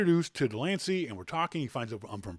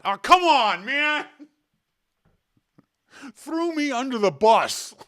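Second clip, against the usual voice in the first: loud, high laughing complaint, slowing at the end; laughing protest; 11 semitones higher, 9 dB louder, slower than usual. Real, not acted. Telling listeners how his friend betrayed him, loud amused protest. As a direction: cry out in amused indignation.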